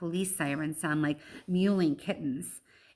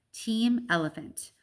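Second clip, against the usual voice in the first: ordinary delivery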